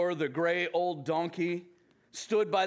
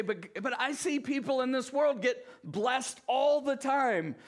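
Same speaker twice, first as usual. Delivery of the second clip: objecting listener; 8 semitones higher than usual. Retold or imitated. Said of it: "You say".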